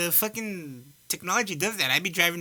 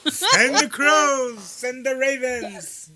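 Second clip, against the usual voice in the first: slow, grand laughing decree; hammed-up royal order to send ravens; mock Game of Thrones command; 9 semitones higher, 4 dB louder, much slower than usual. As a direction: proclaim it like a fantasy lord. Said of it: "Is this fucking Game of Thrones?".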